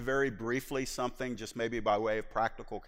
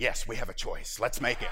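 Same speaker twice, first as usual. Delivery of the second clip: tempting flesh; 3 semitones lower than usual. Retold or imitated. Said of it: "my flesh is saying".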